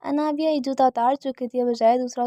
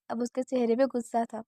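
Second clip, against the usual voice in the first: ordinary delivery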